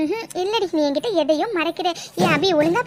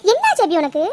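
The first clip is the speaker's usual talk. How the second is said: normal talking voice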